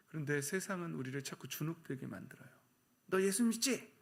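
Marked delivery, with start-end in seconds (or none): 3.1-3.9 high-pitched mocking question; teasing. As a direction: taunt them about their faith. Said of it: "그러면서 놀리려고 그래요". (놀리듯 높은 목소리로)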